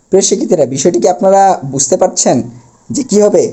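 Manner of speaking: normal talking voice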